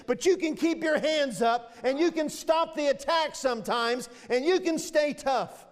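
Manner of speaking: high, driving sermon delivery; impassioned preaching. Real, not acted. Preacher urging the congregation to stand against Satan, impassioned preaching. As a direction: preach it hard, urging them to resist.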